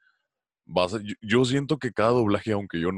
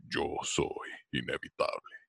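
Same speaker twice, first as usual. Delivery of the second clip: lower, softer and slow Thanos impression; 5 semitones lower, 9 dB quieter, slower than usual. Doing Thanos for friends at a party, lower and slow. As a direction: drop low and slow it down like Thanos. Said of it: "haz la voz de Thanos".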